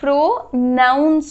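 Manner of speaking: "প্রোনাউন্স" slowly as pro-NOUNS; careful pronunciation. Shown as a demonstration. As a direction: spell out "প্রোনাউন্স" by syllable, with an ow vowel. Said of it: "তোমরা এটাকে কিভাবে".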